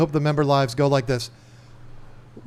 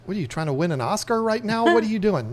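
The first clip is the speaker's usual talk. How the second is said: higher-pitched, incredulous questioning; mock incredulity; 6 semitones higher, faster than usual. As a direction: act out baffled onlookers calling him out. Retold onlookers challenging the put-on redneck.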